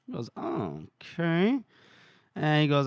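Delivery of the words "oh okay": hesitant agreement. Acted. Farmer's wary reply, acted.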